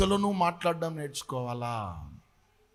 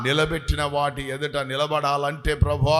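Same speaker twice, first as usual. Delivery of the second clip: earnest, steady prayer voice; 5 dB louder than usual. Real, not acted. Pastor leads the congregation in an earnest prayer. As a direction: pray aloud for the whole church.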